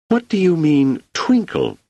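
sharp, high and loud indignant question. A narrator's reading. Doris bristling at Seldon's odd request, indignant. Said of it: "said Doris indignantly".

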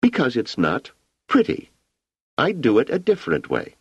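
put-on high, certain child voice; a girl who knows what she likes; matter-of-fact little girl. A narrator's reading. Wanda explains to Hugo it isn't pretty.

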